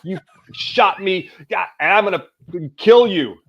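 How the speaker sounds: wounded hunter swearing at his great uncle; retold furious swearing. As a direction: rage at the shooter, climbing to a yell. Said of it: "And I just started swearing".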